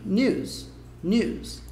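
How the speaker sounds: 'news' between nooz and nee-ooz; in-between vowel. Shown as a demonstration. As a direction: keep only a slight glide in 'news', lips pursed. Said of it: "É um meio termo entre os dois".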